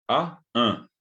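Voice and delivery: "a" sent through the nose as a nasal vowel; nasal Guaraní "a". Shown as a demonstration. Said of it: "pronunciar las vocales nasales".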